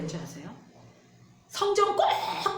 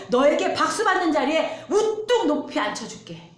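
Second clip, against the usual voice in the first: louder, higher, building sales pitch; tempting devil; 7 semitones higher, 6 dB louder than usual. Acted. Pastor voicing the devil offering fame, building loud. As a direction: tempt them with grand promises, building up. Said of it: "마귀가 이걸 준다고 하는 거예요".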